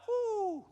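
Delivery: high, loud "whoo"; admiring whoop. Acted. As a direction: whoop as if a dream car passes. Acts out coveting a passing McLaren.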